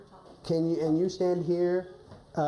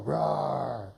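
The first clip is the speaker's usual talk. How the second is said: low, gentle mock growl, half-hearted; lower softer wolf growl; 5 semitones lower than usual. Shown as a demonstration. Coach coaxing shy wolf with a quiet growl. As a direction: murmur a little low rawr. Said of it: "Can you make that voice for me".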